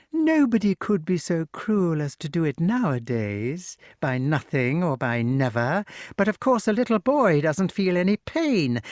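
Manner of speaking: high-pitched, swooping witch voice; gloating witch. A narrator's reading. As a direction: explain the cruelty with mock reassurance. Voices Madam Mim gloating.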